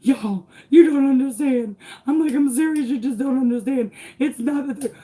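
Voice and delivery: high, loud, frantic pleading. Retold or imitated. Mother trying to make police understand, frantic. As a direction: plead urgently with pitch pushed up. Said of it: "like, frantic".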